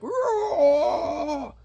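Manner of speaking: high, drawn-out mock monster roar. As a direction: imitate a ghostly creature's wailing roar. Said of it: "turns into some Slimer-looking Ghostbusters thing".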